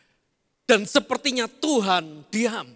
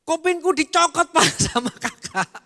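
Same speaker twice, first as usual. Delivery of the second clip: a hint of a small boy telling tales, higher; lightly voiced complaining child; 7 semitones higher than usual. Little son complains to his father about his brother, higher whiny child voice. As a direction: play the little son whining to dad.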